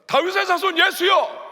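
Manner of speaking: slow, very high, full-force cry; loud drawn-out calling out; shouted plea. Acted. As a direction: yell it out as the desperate blind man. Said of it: "크게 소리를 질렀습니다".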